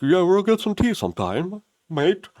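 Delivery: high, put-on British accent, casual and breezy; chirpy fake British promise of tea; mock British accent. To viewers, mocking British friends who never follow through: mock British accent. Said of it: "Unlike those fake British people, they're like".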